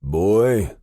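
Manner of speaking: high, loud calling out. A narrator's reading. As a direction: call out to him sharply. Father calls into the phone.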